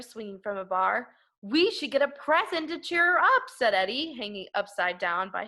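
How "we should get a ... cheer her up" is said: put-on child voice. Child character quote.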